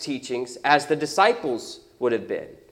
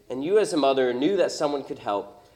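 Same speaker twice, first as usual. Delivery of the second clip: ordinary talk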